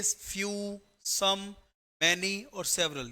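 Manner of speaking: slow listing. Shown as a demonstration. Listing the four words slowly.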